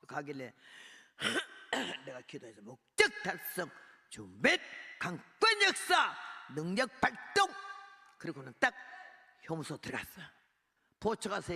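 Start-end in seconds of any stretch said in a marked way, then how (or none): 2.6-7.5 loud commanding prayer. Retold or imitated. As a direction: declare each phrase like a battle cry to God. Bold prayer before prison.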